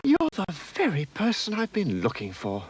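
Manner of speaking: put-on high, breathless eager child; gasped child voice. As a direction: gasp it out, relieved to find him. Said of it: "gasped the elephant's child".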